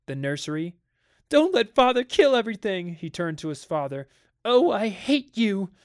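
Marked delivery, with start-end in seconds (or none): 1.3-2.9 put-on high, loud child's plea; wailing plea. A narrator's reading. Child begging the nursery to resist father, wailing. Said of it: "wailed Peter at the ceiling". 4.4-5.7 high, loud, slow drawn-out hatred; childish outburst. A narrator's reading. Child turning on his father, angry outburst.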